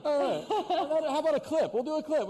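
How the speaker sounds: high, giggly, still laughing. Real, not acted. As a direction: talk through the giggles. Laughing through embarrassment.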